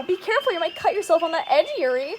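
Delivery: ordinary talk, nothing marked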